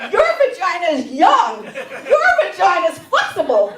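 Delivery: loud, building mock envy; a jealous rant at the young doctor. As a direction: list it with growing scorn. Ranting at young gynecologist.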